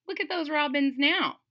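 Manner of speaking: ordinary delivery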